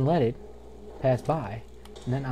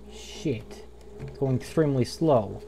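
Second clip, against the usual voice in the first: ordinary delivery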